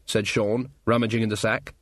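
plain reading voice

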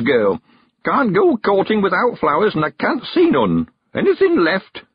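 put-on high old countryman voice; old man sending the girl on an errand, high and eager; Mister Weevil. A narrator's reading. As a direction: lift into a high, chatty old man's voice.

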